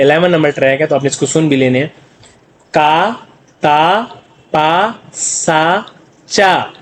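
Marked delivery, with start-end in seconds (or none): 2.7-6.7 long held syllables, one by one; drawn-out syllable drill. Shown as a demonstration. Teacher reads the practice row to students, drawn-out syllables. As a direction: stretch each syllable, pausing between them.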